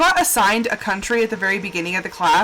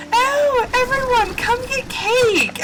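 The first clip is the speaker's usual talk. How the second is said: high, slow sing-song call to the office; cheery call; 12 semitones higher, much slower than usual. Retold or imitated. Coworkers calling the office over for cake, high and slow. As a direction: sing it out high and drawn out, inviting everyone.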